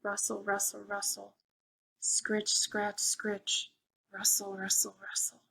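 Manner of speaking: soft, hushed rustle sounds; spooky rustling. A narrator's reading. Reading to kids, softly building suspense with rustles. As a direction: hush it down like something creeping.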